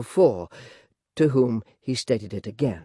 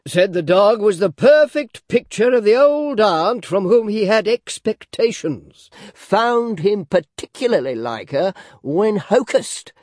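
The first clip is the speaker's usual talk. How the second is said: high, slow, drawn-out telling; slow deliberate speaker; 7 semitones higher, 5 dB louder, slower than usual. A narrator's reading. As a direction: stretch each phrase out. Gun gentleman continues story.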